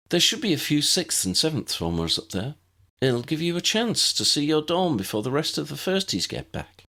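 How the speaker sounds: put-on a cheerful older pupil showing the way; Tessa, friendly schoolgirl.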